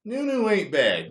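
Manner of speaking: high, loud incredulous nunu; surprised repeat. Real, not acted. Streamer reacting to a chat suggestion, incredulous. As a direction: try the word out in disbelief.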